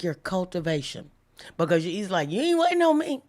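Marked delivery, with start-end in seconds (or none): 2.3-3.2 pitched well up and a touch louder, sassy; sassy comeback. Retold or imitated. God answering the congregation, high and sassy. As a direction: toss it back like it's not on you. Voicing God's sassy reply.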